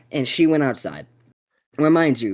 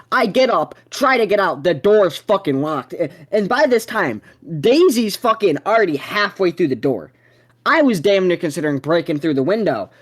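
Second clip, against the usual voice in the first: usual speaking voice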